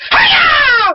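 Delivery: very high karate cry; martial arts yell. Acted. Mimicking Korra showing up to fight, yelling. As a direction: let out a piercing fight cry.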